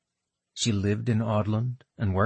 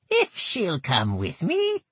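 put-on high, nasal old goblin woman; thick nasal goblin voice; 9 semitones higher, slower than usual. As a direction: pinch it through the nose, goblin. Gertel speaks.

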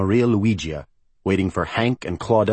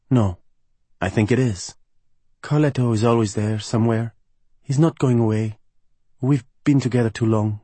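higher, put-on voice of a cheerless woman; Gabriella; 4 semitones higher than usual. Singer explains her long complicated relationship, resigned higher voice.

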